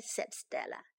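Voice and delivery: ordinary delivery, nothing marked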